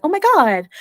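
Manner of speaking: put-on high-pitched Valley girl exclamation; Californian Valley girl. Speaker mocking own casual copy, high Californian Valley girl. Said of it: "I speak like a Californian Valley girl".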